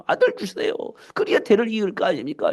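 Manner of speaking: high, mildly imploring retold plea; a touch of angry pleading; crying out in prayer. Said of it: "이렇게 부르짖는 거예요".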